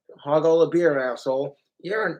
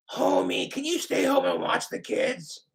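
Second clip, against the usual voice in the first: high, strained wife voice; cartoon wife; 8 semitones higher than usual. Wife asks Homie to watch kids, high strained voice. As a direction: ask pleadingly in a raised cartoon voice. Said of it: "My Simpsons voices".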